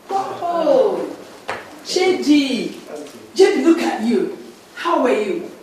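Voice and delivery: gushing greeting. Acted. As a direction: greet him, thrilled and fawning.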